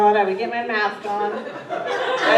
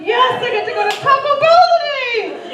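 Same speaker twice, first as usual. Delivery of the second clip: much higher, loud and thrilled; excited squeal; 7 dB louder than usual. Retold or imitated. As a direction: squeal it like a delighted kid. Gleeful over a two-dollar tip.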